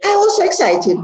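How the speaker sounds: a thrilled, high-pitched retelling; excited. Retold or imitated. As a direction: burst out the memory with glee. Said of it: "I said".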